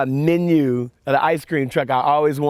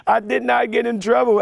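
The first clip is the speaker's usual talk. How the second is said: crying with relief; 11 semitones higher than usual. Retold or imitated. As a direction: cry it out like a kid who just got let off. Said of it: "That made me cry even more".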